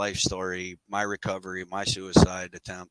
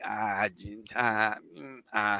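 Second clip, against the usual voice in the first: hesitant ah and mm sounds, unsure; hemming and hawing; 4 dB quieter, slower than usual. Retold or imitated. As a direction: stall with halting ah sounds. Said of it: "him hawing around it".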